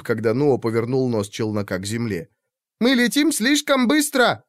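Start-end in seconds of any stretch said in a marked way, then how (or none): 2.8-4.4 much higher alarmed shout. A narrator's reading. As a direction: yell it in alarm. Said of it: "крикнула".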